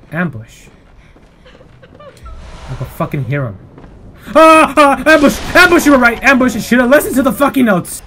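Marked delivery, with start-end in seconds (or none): 4.3-8.0 high, loud shouts of alarm; panicked yelling. Real, not acted. Player caught in an ambush, panicked yelling. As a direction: yell out in real panic.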